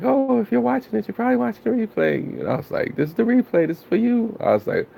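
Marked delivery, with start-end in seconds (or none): 0.0-2.2 high, quick feminine voice; Cargo voice. Retold or imitated. Streamer imitating Cargo's stream intro, high and quick. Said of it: "she said it in the Cargo voice". 3.0-4.4 high playful voice; imitating her. Streamer still mimicking Cargo, high and playful.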